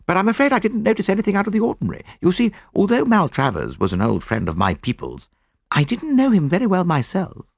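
put-on high, quick, eager young officer; young soldier. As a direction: lift the voice and rattle it off helpfully.

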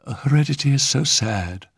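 high-pitched, vague society lady; vague sighing. A narrator's reading. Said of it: "sighed the lady vaguely".

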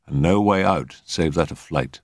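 usual speaking voice

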